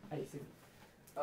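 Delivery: breathy give-up whisper. Real, not acted. Streamer giving up on his live, whispered. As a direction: drop to a defeated whisper.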